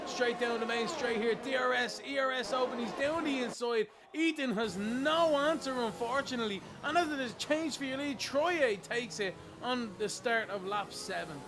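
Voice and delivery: loud, excited play-by-play call; motorsport commentator. Real, not acted. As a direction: call the overtake at full pitch. Lead change called live.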